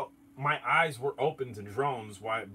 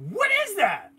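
much higher, louder startled question; suddenly alarmed by the noise; 7 dB louder than usual. Retold or imitated. Retelling alarm at strange noise.

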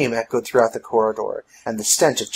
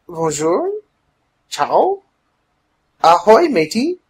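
tentative greetings, each pitched higher; trying out greetings; 8 semitones higher, 5 dB louder, slower than usual. A narrator's reading. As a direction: test each greeting on a stranger, rising. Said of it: "she tried other languages".

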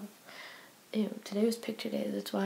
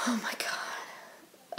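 drawn-out whisper; 6 dB quieter, much slower than usual. Real, not acted. Trailing off, exhausted.

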